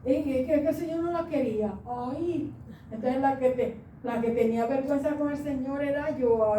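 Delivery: ordinary talk, nothing marked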